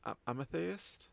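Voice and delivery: AMETHYST attempted slowly in Scottish, rising like a question; tentative Scottish accent. Shown as a demonstration. Game master trying a tricky word, unsure Scottish accent. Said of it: "say the word properly in a Scottish accent".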